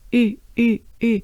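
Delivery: repeated teacher's demo of French "U". Shown as a demonstration. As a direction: say "U" with jaw dropped and small opening. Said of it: "it should sound like U".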